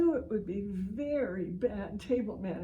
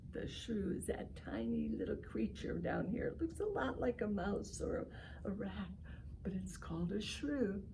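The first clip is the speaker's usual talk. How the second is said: softer, half-whispered explanation; soft hushed aside; 6 dB quieter than usual. Acted. Pointing out tiny shrew.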